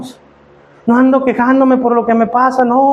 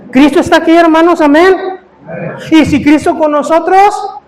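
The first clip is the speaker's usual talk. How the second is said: raised, ringing preacher voice; preaching at full force; 5 semitones higher than usual. Acted. Pastor rallying the brothers, preaching at full force. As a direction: lift the congregation with a rising call.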